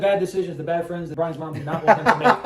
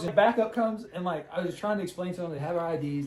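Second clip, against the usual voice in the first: ordinary delivery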